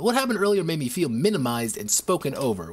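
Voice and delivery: ordinary talk, nothing marked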